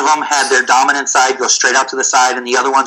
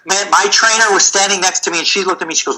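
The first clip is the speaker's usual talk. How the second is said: usual speaking voice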